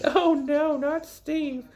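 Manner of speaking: high falsetto mock dismay; mock girly alarm. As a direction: squeal it like a fake-worried girl. Girl voiced in retold story.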